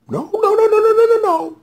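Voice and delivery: frantic no. Retold or imitated. Denying what just happened in loud high no's. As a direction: refuse to believe it. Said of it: "I'm like".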